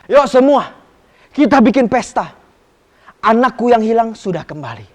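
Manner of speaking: loud, happy announcement; joyful father. Acted. The father calling the servants to celebrate, loud and joyful. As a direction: call everyone to the party.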